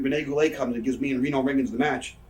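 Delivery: normal talking voice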